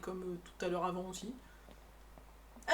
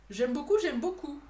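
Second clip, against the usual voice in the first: louder, delighted repeat; excited; 5 semitones higher, 6 dB louder than usual. Real, not acted. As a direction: gush over it out loud.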